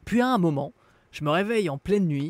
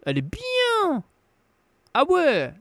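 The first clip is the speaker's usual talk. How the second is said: enthusiastic; 12 semitones higher, 4 dB louder, slower than usual. Real, not acted. Enthusiastic praise, very high.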